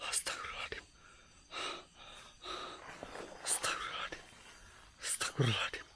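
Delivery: frightened breathy whisper; scared whisper. Real, not acted. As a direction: whisper prayers in dread. Whispered prayers in fear.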